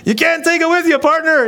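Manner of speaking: very high, loud exclamation; mock folksy shout; hammed-up agreement. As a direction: holler it like a gleeful punchline. Acted reply to Southern woman's quip.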